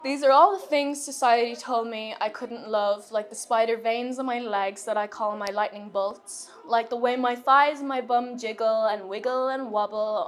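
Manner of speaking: measured spoken-word cadence with stressed rhymes; poem recitation. Acted. Poet reading "Trinkets" to an audience, spoken-word recital. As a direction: recite it as a personal list poem.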